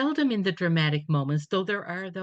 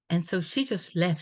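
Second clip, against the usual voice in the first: ordinary talk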